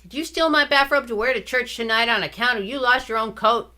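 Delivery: put-on a small boy demanding answers, voice up; little brother accusing his sister, voiced high; accusing kid brother.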